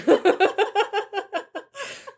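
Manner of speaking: squealing giggle; high-pitched burst of laughter. Real, not acted. Laughing after Disney joke.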